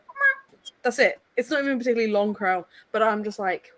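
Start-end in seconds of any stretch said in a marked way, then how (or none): 0.0-0.6 high, plaintive imitated meow; cat meow imitation. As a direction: mew like the timer cat. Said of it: "it's just like".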